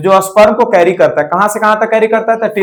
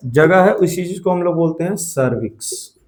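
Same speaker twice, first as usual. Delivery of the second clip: ordinary talk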